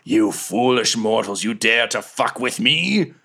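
arrogant sea monster roars at mortals; booming whale voice. Acted. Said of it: "the voice that you thought was Kazrad being like".

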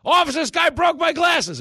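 very high, loud calls for an officer; shrill hammed-up cry for help; yelling for the cops. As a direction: yell for the police like the victim. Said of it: "the guy just starts yelling".

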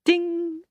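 high, ringing ding; an idea lighting up; bright ding. Acted. Idea sound effect.

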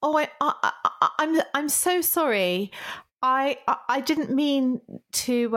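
high, halting, stammered apology; playing at being very sorry, stretched and hesitant; mock flustered apology. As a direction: stumble over a pretend apology. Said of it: "You want me to apologize for these things".

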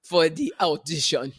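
imitating an audition African accent. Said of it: "We all were trying to do an African accent".

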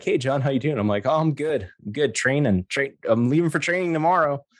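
high-pitched, upbeat reenacted greeting; acted cheeriness. Acted cheerful greeting before bad news.

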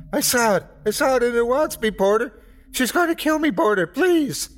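high-pitched terrified pleading; frantic screaming. A narrator's reading. Crazed man begging Porter to save him, frantic scream. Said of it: "as he screamed".

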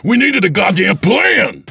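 high, loud angry yelling; furious shout. Acted. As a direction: bawl him out for his recklessness. Abel furious at reckless Tep.

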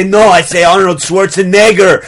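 mock yell with a muddled European accent. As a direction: yell it indignantly in a put-on accent. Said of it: "Now you're yelling it".

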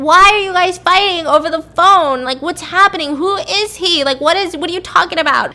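put-on high, loud panicked kid; upset child voice. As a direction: voice a frightened little girl crying out. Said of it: "Olivia starts crying in the back".

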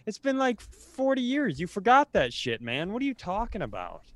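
ordinary talk, nothing marked

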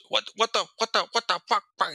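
high, stammering broken-English caller; broken-English cursing. Retold or imitated. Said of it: "cursing at me in half-broken English".

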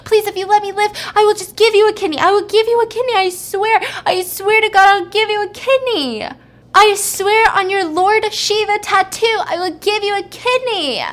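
high, loud, desperate begging, acted out; screaming plea. Patient begging surgeons to spare him, acted screaming. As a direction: beg for your life at full pitch. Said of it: "he starts screaming".

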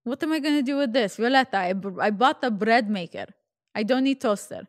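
put-on fed-up Russian-accented mother; mock Russian mom, exasperated; poor Russian accent. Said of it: "my really poor Russian accent".

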